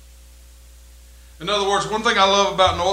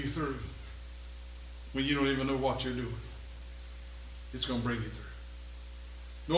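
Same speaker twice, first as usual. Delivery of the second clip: soft, low voice of Jesus to Peter; gentle reassurance; 4 semitones lower, 9 dB quieter than usual. Acted. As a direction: reassure a friend quietly, with pauses between phrases. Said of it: "Jesus said to Peter".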